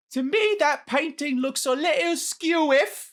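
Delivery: high, hammed-up British accent; British dialect. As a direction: read it as a cartoonish British local. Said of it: "all the different dialects".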